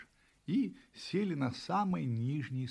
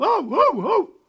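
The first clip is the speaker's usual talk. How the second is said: high, very loud puppy yaps; loud barking; 14 semitones higher, 14 dB louder, slower than usual. A narrator's reading. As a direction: bark like an excited puppy. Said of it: "громко лаял". (громкий щенячий лай)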